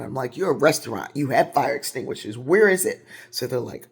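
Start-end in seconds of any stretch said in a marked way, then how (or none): 0.4-3.1 loud, rising exasperation; exasperated demand. Retold or imitated. Telling restaurant staff they must have an extinguisher, loud and exasperated. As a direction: demand it from the staff, louder each line.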